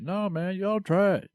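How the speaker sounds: high-pitched, lightly acted coaxing; trying to convince. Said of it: "he was trying to convince".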